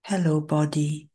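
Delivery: ordinary talk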